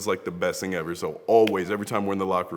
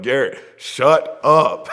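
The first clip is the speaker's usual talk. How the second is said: louder, slow, drawn-out mock scolding; imitating Nate; 7 semitones higher, 14 dB louder, much slower than usual. Nate telling Garrett off for his stories, mock exasperated. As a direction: stretch it out like a fed-up buddy. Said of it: "Nate would be the one to be like".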